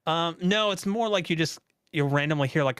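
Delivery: usual speaking voice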